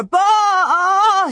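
high, loud, drawn-out animal bawl; bawling calf. A narrator's reading. Star bawls as the calves bolt, loud animal cry. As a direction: bellow like a frightened calf.

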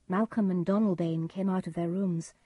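ordinary delivery

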